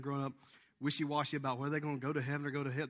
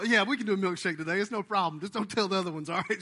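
indulgent dad; 4 semitones higher, 8 dB louder than usual. Retold or imitated. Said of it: "you know".

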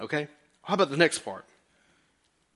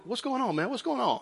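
higher, softer laid-back greeting; breezy, a little higher and softer; John chilling while eating, casual greeting; 7 semitones higher, 5 dB quieter than usual. Retold or imitated. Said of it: "He's over there dipping stuff".

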